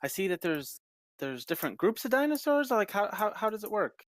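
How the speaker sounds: put-on high, puzzled girlish voice with a sigh; confused young daughter. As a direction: sound lost like a young girl.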